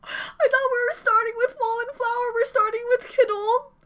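high, tearful squeaky wail; about to cry. Real, not acted. Fan shocked that Kidult comes first, near tears. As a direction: wail in overwhelmed disbelief, voice way up high. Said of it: "I'm gonna cry".

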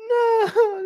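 high wailed fake sob; hammed-up tearful protest; mock crying. Arjun in tears before the battle, mock wailing. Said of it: "like, in tears".

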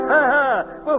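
usual speaking voice

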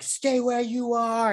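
high, slow put-on order; drawn-out mock official command; mock guard command. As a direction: imitate guards calling out a halt. Acting out guards ordering her.

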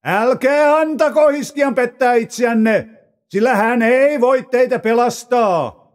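shouting herald addressing the crowd; loud proclamation. A narrator's reading. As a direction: proclaim it up to the people on the wall. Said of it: "huusi kovalla äänellä".

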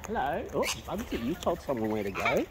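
soft, gentle teasing of a pet. Real, not acted. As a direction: murmur the scolding tenderly. Softly telling off the bird.

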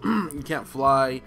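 usual speaking voice

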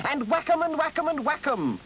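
very high, chanting battle cry; gleeful cry. A narrator's reading. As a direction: cry it out high, leaping with excitement. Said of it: "cried the Toad, leaping into the air".

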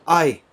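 high, loud shriek; piercing scream. A narrator's reading. Clara shrieking as though her throat were cut. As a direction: shriek as if in pain. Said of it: "as though she were having her throat cut".

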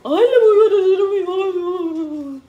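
whiny fake sob, slow; mock sobbing complaint; mock crying. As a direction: ham up a crybaby running to mom. Mocking youth whining to mother.

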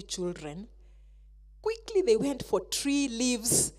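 usual speaking voice

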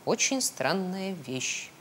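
slow, soft musing; hushed storybook bear in wonder; puzzled bear. A narrator's reading. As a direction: say it slowly and quietly, pondering. Puzzled Pooh examines the tracks.

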